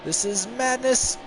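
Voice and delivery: very high, loud falsetto reacting to the wreck; a squeaky hammed-up driver panicking; falsetto race voice.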